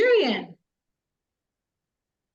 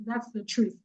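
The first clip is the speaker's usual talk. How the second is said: ordinary talk, nothing marked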